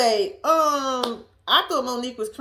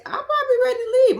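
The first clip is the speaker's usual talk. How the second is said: rushed excited blurt; 13 semitones higher, 6 dB louder, much faster than usual. Real, not acted. Admitting she'd be scared too.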